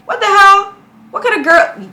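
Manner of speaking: outraged. Real, not acted. Reactor shouting at the story, outraged. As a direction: blurt it in disbelief.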